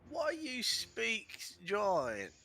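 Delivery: high, very slow dim giant; dopey cartoon bellow. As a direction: drag out each word like a dull brute.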